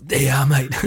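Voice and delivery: imitated Australian star, offhand; Russell Crowe. Crowe throws a grand into the pot, imitated casual Aussie. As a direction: put on a broad Aussie mate voice. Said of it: "Stop doing the voice".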